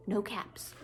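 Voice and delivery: whispered storytelling suspense; hushed whisper. A narrator's reading. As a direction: drop to a whisper, as if disappointed. Hushed reveal while reading a story.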